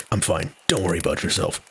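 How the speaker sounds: low, tired sick-man Walt; lightly voiced Walt. Walt brushing off Jesse, low tired voice. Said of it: "I don't know what he sounds like".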